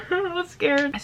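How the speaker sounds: laughing, high and soft. Real, not acted. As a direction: giggle out a mock scared line. Laughing fear after joke.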